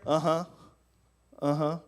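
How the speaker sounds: low, disinterested acknowledgement; acted ignoring. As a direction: pretend to listen while tuning her out. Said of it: "I'm just ignoring her".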